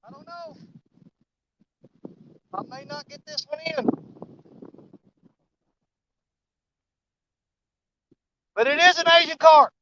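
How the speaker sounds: straining, breathless, high and excited; struggling to reel in a heavy fish; fighting a fish. Real, not acted. Worried about landing fish.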